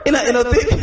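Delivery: laughing while talking, pitch up. Real, not acted. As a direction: laugh through the words. Laughs at the crowd story.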